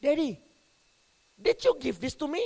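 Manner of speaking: playing the clever little son asking; child voice. Son challenging his father about the toy, a hint of a child's voice. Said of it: "lalu dia ngomong gini".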